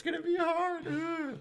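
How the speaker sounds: very high squealed groan; mock whining. Mock dread at the gig ahead.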